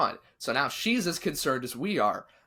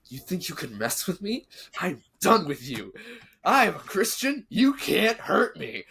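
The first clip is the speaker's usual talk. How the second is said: mock threat; 7 semitones higher than usual. Grabbing doll by throat, threatening.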